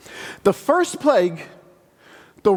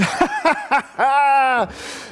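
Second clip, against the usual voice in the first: a higher, scornful laugh; mocking laugh; 6 semitones higher than usual. Egypt's leader laughing at Moses, scornful laugh. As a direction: laugh off the demand. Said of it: "the Egyptian leader is".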